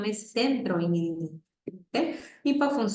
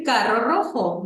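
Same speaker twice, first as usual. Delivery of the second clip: slow, louder "Carro rojo"; slow modelling; 2 semitones higher, 5 dB louder, slower than usual. Shown as a demonstration. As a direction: spell out the phrase for the child. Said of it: "tú le puedes decir".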